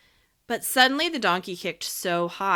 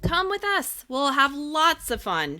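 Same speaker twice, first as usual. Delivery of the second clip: mild shout; 8 semitones higher, 4 dB louder than usual. A narrator's reading. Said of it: "shouted some voices".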